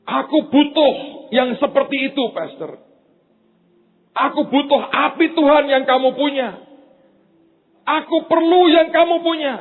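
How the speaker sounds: put-on high, loud, tearful plea of a little boy; pleading child. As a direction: cry out like a weeping child begging for prayer. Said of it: "dia berkata". (memohon sambil menangis, suara anak kecil tinggi dan keras)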